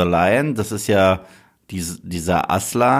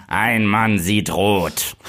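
very slow, hard trailer line; aggressive German trailer voice; much slower than usual. Shown as a demonstration. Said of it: "die deutsche ist wirklich aggressiv".

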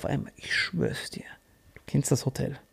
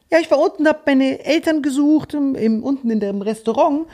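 put-on high little girl explaining; child's voice; 6 semitones higher than usual. Girl explains how she got lost, high child voice. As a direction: tell it like a small girl.

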